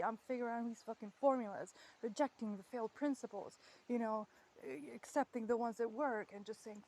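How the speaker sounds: ordinary talk, nothing marked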